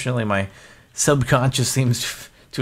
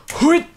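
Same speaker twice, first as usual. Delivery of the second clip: high call. Pretend. Calling into the mine.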